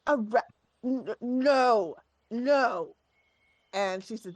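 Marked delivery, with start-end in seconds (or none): 0.1-3.0 sputtering, indignant refusal; raised, flustered protest; really mad. Acted. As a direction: stammer in outrage, then refuse. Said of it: "like, really mad".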